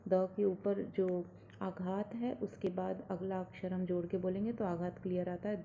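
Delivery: ordinary talk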